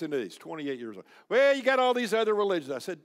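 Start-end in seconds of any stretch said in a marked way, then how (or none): 1.3-2.7 much louder, high-pitched imitation of an objector; mock objection. Groom argues other religions with preacher, loud mock objection. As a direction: burst out with a loud, high protest. Acts out young man's objection.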